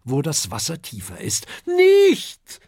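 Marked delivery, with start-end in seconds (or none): 1.7-2.6 very high, loud cry of alarm; alarmed shout. A narrator's reading. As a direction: shriek to stop him. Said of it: "rufen Adele und Herbert".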